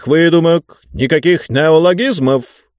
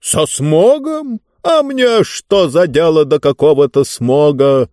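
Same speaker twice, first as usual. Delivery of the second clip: high, drawn-out incredulous question; pompous ruler dismissing the very idea; scornful indignation; 5 semitones higher, 4 dB louder, slower than usual. Acted. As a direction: throw it back, affronted. Ruler scornfully answers the girl's plea.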